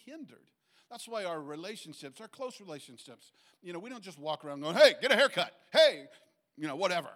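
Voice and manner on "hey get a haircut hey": much louder, laughing order; mock bossy nag. Preacher mocks people ordering others around, loud and laughing. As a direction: bark it like a nagging know-it-all.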